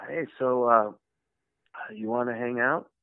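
softly hesitant, awkward invitation; nervous. Retold or imitated. Fan asking his idol to be friends, mock nervous. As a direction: float it timidly with pauses. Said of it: "I'm nervous because I believe".

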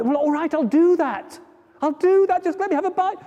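flea voice, high and desperate, near tears; high pleading flea. Acted. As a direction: beg for food in a high voice. Flea agrees eagerly.

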